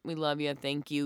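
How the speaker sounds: usual speaking voice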